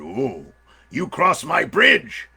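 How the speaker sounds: slow, drawn-out menacing bear; big storybook bear, very slow; hungry bear. Acted. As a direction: savour each word like a hungry bear.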